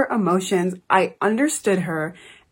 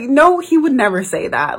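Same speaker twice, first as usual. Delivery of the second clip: retold protest; 6 semitones higher, 6 dB louder than usual. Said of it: "And I was like".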